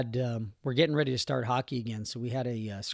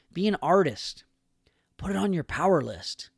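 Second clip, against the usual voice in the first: whisper; 5 semitones higher than usual. Real, not acted. Urging listeners on a podcast.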